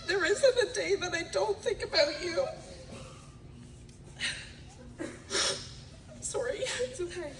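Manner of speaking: choked up between laughs and tears; fighting back tears, then steadying; high, tearful with a nervous laugh; emotional, voice breaking. Real, not acted. Voice breaks reading eulogy for parents.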